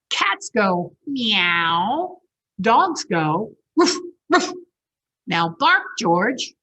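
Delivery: slow, loud, drawn-out lesson; patient mother teaching. Acted. Mother slowly teaching George the sounds, patient and loud. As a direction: spell it out slowly for the pup. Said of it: "said his mother".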